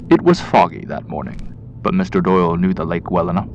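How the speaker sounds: usual speaking voice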